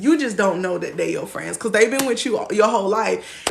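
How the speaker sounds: usual speaking voice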